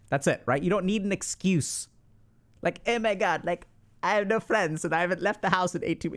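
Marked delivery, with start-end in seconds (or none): 2.9-6.2 lightly voiced anxious oversharer; oversharing nervous intro. A lightly mocked oversharer blurting his excuse. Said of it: "You don't need to say that".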